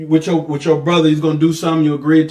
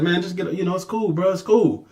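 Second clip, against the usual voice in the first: much softer, offhand imitation; mock brush-off; 7 dB quieter than usual. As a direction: wave it off softly in mock. Mimicking brushing it off.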